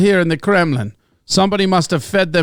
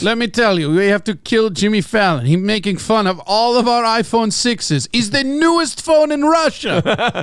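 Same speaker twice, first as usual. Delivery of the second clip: put-on Russian accent; 3 semitones higher than usual. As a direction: protest in a mock Russian accent. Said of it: "you can do a Russian accent".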